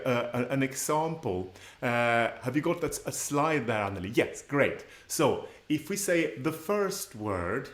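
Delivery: ordinary delivery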